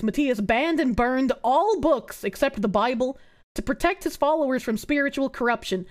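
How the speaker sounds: ordinary talk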